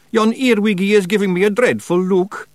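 high, loud frightened whine; begging. A narrator's reading. Feegle shrinks from earwig's look, begging. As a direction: plead in high-pitched Scots dread. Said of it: "he begged".

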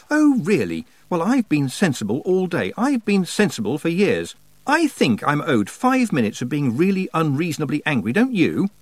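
high, indignant self-justification; indignant rant. A narrator's reading. Tiffany claiming her right to be unreasonably angry, indignant. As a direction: argue back, pitch up and incensed. Girl rants at her own thoughts.

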